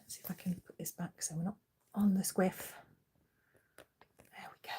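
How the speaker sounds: soft unvoiced whisper. Real, not acted. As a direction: whisper while fixing chair. Adjusting chair quietly.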